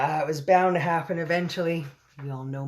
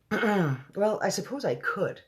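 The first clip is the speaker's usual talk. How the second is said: grudging admission, higher pitch; 7 semitones higher, much slower than usual. A narrator's reading. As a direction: concede it reluctantly, starting high. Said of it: "admitted grudgingly".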